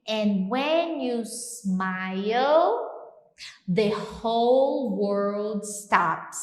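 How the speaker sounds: slow, spoken lyric; slow song lyric. Shown as a demonstration. Teacher reciting a love song to students, slow. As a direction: savour the lyric slowly. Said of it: "Então, quer dizer".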